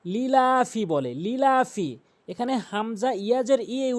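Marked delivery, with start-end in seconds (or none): 0.0-0.8 লিলাফি with no separate hamza ee; common mispronunciation. Shown as a demonstration. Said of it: "অনেকে যে ভুলটি করে". 1.2-1.9 লিলাফি with no separate hamza ee; common mispronunciation. Shown as a demonstration. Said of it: "অনেকে যে ভুলটি করে".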